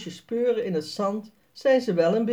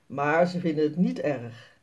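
ordinary delivery, nothing marked

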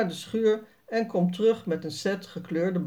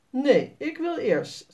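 higher and slow, stubbornly insisting; stubborn girl, Sanne; 6 semitones higher, slower than usual. A narrator's reading. Sanne refuses to let Jasper go first, slow and insistent. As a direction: draw it out like a stubborn little girl. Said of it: "zegt Sanne".

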